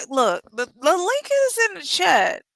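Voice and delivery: high-pitched, exasperated reminder; exasperated insistence. Real, not acted. Host reminding the chat where the link is, exasperated.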